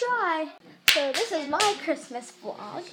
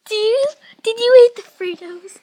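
high, louder sing-song voice for the dog; playful pet-talk questioning; 8 semitones higher, 8 dB louder than usual. Real, not acted. Asking the dog about Fritos.

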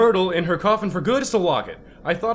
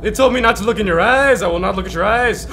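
ordinary talk

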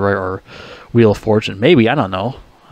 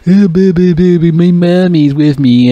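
put-on higher, louder, slow whiny baby voice; mocking mama's boy; 6 semitones higher, 5 dB louder, slower than usual. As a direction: whine like a grown man crying for mommy. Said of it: "he always talked about his mom".

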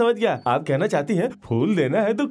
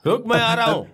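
high hail across a distance; calling out; 5 semitones higher than usual. Acted. Creditor chasing a debtor, calling out. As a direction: call after him to wait. Calls after departing debtor.

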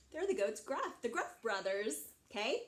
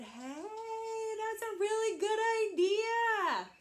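delighted approval; 11 semitones higher, 4 dB louder than usual. Acted. Big brother hearing the littlest one's plan, excited. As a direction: exclaim it with great approval.

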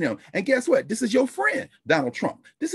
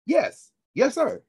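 deferential phone answer; 7 semitones higher, much slower than usual. Acted. Acted phone call to a CEO.

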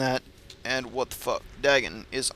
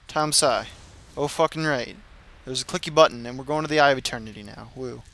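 ordinary talk

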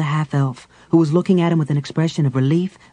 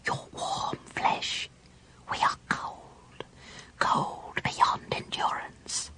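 slow, soft, hungry spirit whisper; dead spirits luring the man closer; ghostly hissing whisper; 12 dB quieter, much slower than usual. Acted. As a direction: lure him in, softly and slowly. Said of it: "whispered soft, hissing voices".

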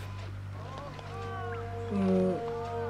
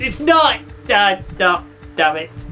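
frustrated outburst; 6 semitones higher, 6 dB louder, slower than usual. Real, not acted. Disappointed item not in room.

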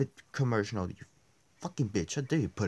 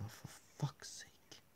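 soft breathy whisper under the breath; whispered curse; 18 dB quieter, slower than usual. Real, not acted. Frustrated player whispering a curse. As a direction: whisper it to yourself. Muttered aside.

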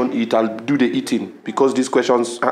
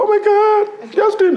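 much higher, excited gush; fangirl squeal; slower than usual. Acted. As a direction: squeal like a starstruck fan. Fangirl squeal over Justin.

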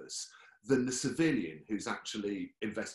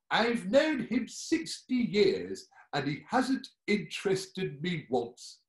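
put-on a slow, higher, louder old wife's grumble; Percy's wife; 7 semitones higher, 4 dB louder, slower than usual. Old wife complains about Percy, slow and higher. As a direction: complain slowly about a dull husband. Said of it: "we have Percy's wife".

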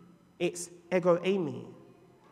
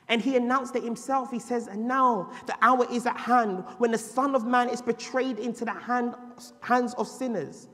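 raised, ringing sermon voice; preaching; 6 semitones higher, 6 dB louder than usual. Acted. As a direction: proclaim it to the church. Preacher reading scripture with force.

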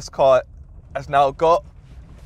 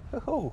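excited whoop; 12 semitones higher, 8 dB quieter than usual. Real, not acted. Splashing into a puddle.

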